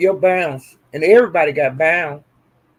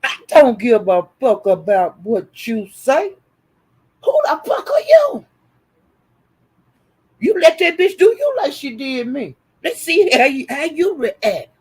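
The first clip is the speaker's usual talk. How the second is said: scripted confrontation; 6 semitones higher than usual. Acted. Streamer scripting what Sassy should yell at her enemies, furious shout. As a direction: snap back at them, furious. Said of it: "Tell 'em".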